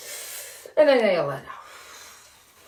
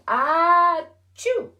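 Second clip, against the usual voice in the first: big playful fake sneeze, swooping up high; hammed-up "ah-chew" for the kids; pretend sneeze; 6 semitones higher than usual. Teacher to children reading a story, a mock sneeze. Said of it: "That's a pretend sneeze".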